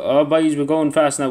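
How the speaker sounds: real thrill of a quick streak; excited cheer. Real, not acted. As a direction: cheer yourself on as the answers fly. Cheering himself on mid-quiz.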